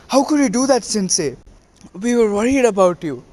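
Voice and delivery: high, worried reproach from a student; mock-scold the teacher, voice jumping up; lightly voiced girl chiding her sensei; upset scolding. Student scolds teacher after fight.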